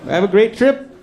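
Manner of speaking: a lightly voiced PA announcer confessing; airport PA announcer. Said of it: "baggage three claim area".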